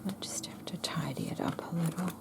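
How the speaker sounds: quick whispered aside; whispering. Real, not acted. Muttering to herself while tidying.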